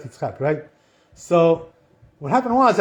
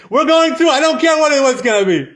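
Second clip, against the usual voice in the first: defiant shout; 8 semitones higher, 6 dB louder than usual. Retold or imitated. Abraham and Yitzchak defy the river.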